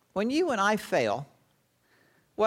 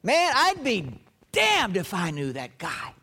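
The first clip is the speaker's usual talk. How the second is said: cursing denial. Acted. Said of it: "Something along this line".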